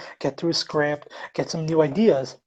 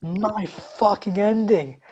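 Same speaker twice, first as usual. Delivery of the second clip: drawn-out, higher-pitched indignation; indignant reveal; 4 semitones higher, slower than usual. Real, not acted. Storyteller reveals to a friend which ending was used, indignant. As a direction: land it slowly as the outrageous punchline.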